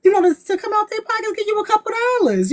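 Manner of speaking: sweet self-quote. Retold or imitated. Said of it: "I was like".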